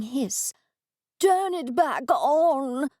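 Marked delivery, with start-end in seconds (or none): 1.2-2.9 slow, imperious cat voice, pitched up; a lordly cat giving orders; a cat demanding its show back; haughty cat. A narrator's reading. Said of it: "the cat said".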